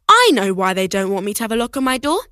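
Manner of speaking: ordinary delivery, nothing marked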